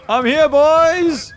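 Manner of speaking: loud, high, drawn-out holler; big slow arrival shout; calling out. Real, not acted. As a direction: announce yourself to the whole battlefield. Announces arrival at the battle.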